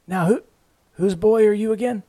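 put-on high, frail, puzzled old woman; a lost grandma asking again; confused old grandmother. A narrator's reading.

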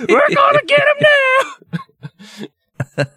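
high, loud whooping yell; hammed-up crowd cheer; mob shout. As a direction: holler like a rowdy lynch mob.

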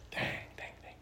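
soft whispered dang, fighting tears; whispered through tears. Real, not acted. Father leaving a message for his kids, whispering tearfully. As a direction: breathe the words out, holding back crying. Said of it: "now I'm gonna cry".

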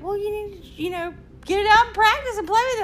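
imitating mom. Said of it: "She's like".

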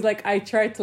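ordinary delivery

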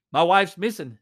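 loud alarmed call; retold yell. Husband telling the patrolman his wife is gone, loud yell. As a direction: blurt it out loudly. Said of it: "he yelled".

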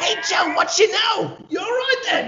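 high, put-on British hello; hammed-up mock English accent; a playful bad English accent greeting a London guest. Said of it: "I can't have a good accent".

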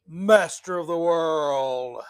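lightly voiced supervillain, slow and drawn out; slow mock-villain pronouncement. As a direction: stretch it out like a would-be world ruler. Mock villain declaring world domination.

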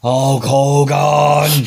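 slow drawn-out loud promo shout; Warrior impression. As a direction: drag the name out like a ring promo. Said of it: "just did Warrior".

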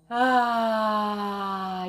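strong, sustained voiced "aah"; tension-releasing sigh. Shown as a demonstration. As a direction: release the tension through a long open sound. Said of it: "solta essa tensão através deste som".

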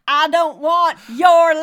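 loud desperate yell. Retold or imitated. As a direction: yell it out in anguish. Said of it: "he's yelling".

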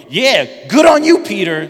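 a loud, high cheer on "good on you"; Jesus praising Peter, loud and thrilled; excited praise. Acted. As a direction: burst out cheering.

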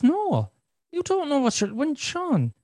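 high-pitched mock exasperation; imitated exasperated refusal. Wife refusing husband's offer, imitated exasperation. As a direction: act out a partner firmly shutting it down.

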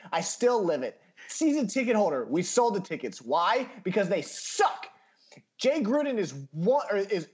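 worked-up tirade, key words hit hard; passionate rant. Real, not acted. Fan railing at the team's owner, passionate rant. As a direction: let loose on a team you're fed up with. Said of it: "Just passion".

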